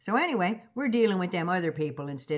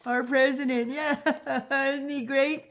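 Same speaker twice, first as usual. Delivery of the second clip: high giggling yes-man voice; fawning cheerleader; 5 semitones higher, slower than usual. Retold or imitated. Mocking Lutnick cheering for his president, high giggling gush. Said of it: "always smiling and clapping".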